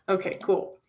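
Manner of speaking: normal talking voice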